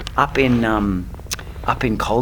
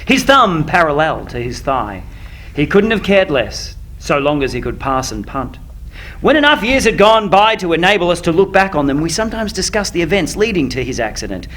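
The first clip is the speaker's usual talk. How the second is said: story reading; 4 semitones higher than usual. Acted. Reads opening of the novel.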